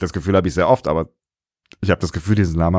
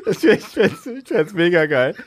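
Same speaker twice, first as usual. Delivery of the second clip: laughing; 8 semitones higher than usual. Real, not acted. Laughing through the line.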